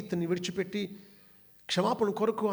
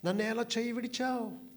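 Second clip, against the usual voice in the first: mild mock cry; 6 semitones higher than usual. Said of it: "బిగ్గరగా కేకలు వేస్తున్నాడు".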